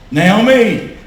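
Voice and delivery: loud, drawn-out call of a name. Retold or imitated. Husband to his wife on the office step, calling out. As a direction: call to her with urgency.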